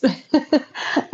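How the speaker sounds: light rising laugh. Real, not acted. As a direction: laugh warmly at the goodbye. Laughs while saying goodbye.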